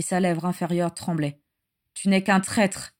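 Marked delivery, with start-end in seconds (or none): none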